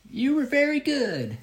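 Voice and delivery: put-on high, drawn-out old lady voice; very slow old woman. Tiny old woman praises the juggler backstage, very slow and high. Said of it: "she comes up to me and very slow".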